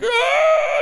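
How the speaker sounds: very high loud mock scream; 1 pained scream. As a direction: let out a shrill mock scream. Screaming while scraping skin.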